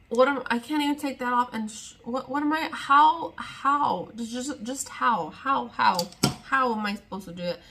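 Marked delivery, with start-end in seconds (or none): none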